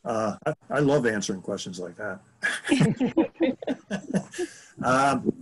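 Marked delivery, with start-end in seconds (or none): 2.4-4.4 hearty laugh. Real, not acted. Laughs at own remark.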